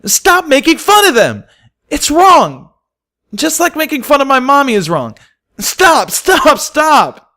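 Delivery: high, loud tearful yelling; crying shout. A narrator's reading. Filly shouting at classmates to stop teasing. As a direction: scream at them through tears. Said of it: "Dinky cried".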